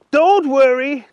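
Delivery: high character voice; reassuring Archie. A narrator's reading.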